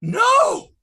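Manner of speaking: explosive high-pitched cry of refusal; hammed-up howl of disbelief; outraged shout. Answers own incredulous question.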